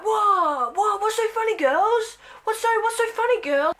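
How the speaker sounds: высоким голосом, глупый папа; dad voice. Pretend. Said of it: "he'd be like".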